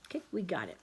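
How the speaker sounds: ordinary delivery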